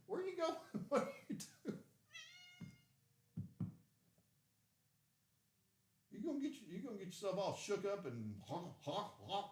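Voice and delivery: very high, laughing pet voice. Real, not acted. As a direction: tease the cat playfully. Teasing the excited cat.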